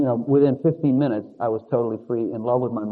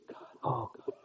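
whispered prayer; 17 dB quieter than usual. Retold or imitated.

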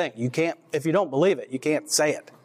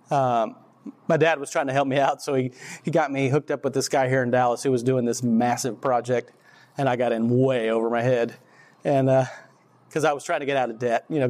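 usual speaking voice

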